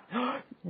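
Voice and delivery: put-on high, frightened gasp of a toddler; a sharp scared intake of breath; child's gasp. Son panics in stream.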